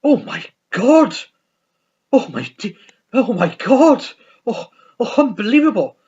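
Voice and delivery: scandalised to the core by what he sees; shocked manager. Retold or imitated. Manager looking at the photos, loudly scandalised. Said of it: "he goes".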